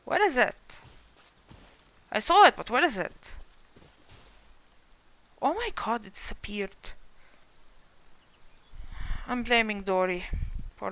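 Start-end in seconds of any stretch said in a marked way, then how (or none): none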